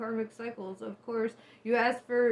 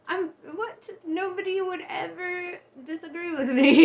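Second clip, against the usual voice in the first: high mock self-righteous outrage; 8 semitones higher than usual. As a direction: huff it high, full of yourself. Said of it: "my self-righteousness".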